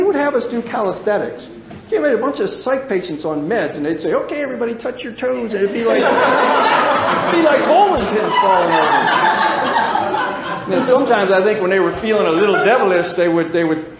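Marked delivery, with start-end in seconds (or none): 4.1-5.5 bright, high coach call to the group; mock chipper exercise leader; cheery instructor. Staff leading patients' calisthenics. 5.5-13.9 talking through real laughter; loud, laughing storytelling; words pushed out between laughs. Real, not acted. Laughing at the memory.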